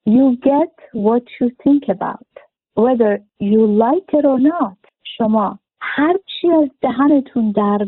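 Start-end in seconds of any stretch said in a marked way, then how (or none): 0.1-4.9 very slow, each word weighed, stress on think; slow, deliberate slogan; slow emphatic motto. Shown as a demonstration. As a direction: spell the motto out slowly to drive it home. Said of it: "teaching motto, slow and stressed".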